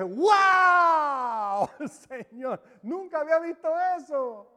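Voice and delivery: high, loud Wow breaking into a laugh; excited cry. Retold or imitated. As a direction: relive the thrill of discovery. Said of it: "Grité y dije".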